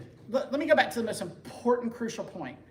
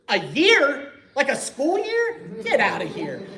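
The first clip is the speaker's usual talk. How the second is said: high, loud mock disbelief at a school year; incredulous disbelief; 7 semitones higher, 8 dB louder, slower than usual. Retelling his own reaction to the long wait, in mock disbelief. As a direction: scoff at the idea like it's absurd. Learning conversion takes a year.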